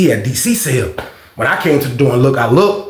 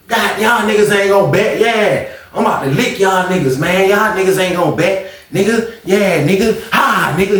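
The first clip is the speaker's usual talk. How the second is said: higher-pitched, lively taunting; dice-game trash talk; 5 semitones higher than usual. Retold or imitated. Gambler taunts players at the dice game, imitated trash talk. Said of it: "He be at the dice game".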